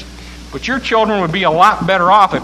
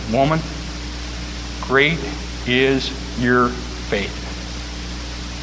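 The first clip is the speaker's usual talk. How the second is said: tender praise; 4 semitones lower, 5 dB quieter, much slower than usual. Acted. Jesus gently praising.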